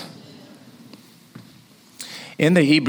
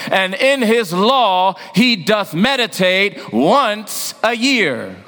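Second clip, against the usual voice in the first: preaching at full force; 11 semitones higher than usual. Acted. Preacher drilling the psalm with the congregation, full preaching voice. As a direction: proclaim the psalm to the whole church.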